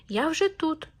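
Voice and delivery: high mock shout; hedgehog calls out. A narrator's reading. Hedgehog calls to the hare again, high and light. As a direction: tease the hare with it. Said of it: "а їжак йому кричить".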